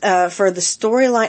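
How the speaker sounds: usual speaking voice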